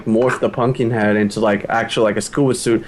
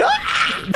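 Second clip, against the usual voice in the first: high-pitched yelp of fright; mock freak-out scream; 3 dB quieter than usual. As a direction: squeal as if scared out of your wits.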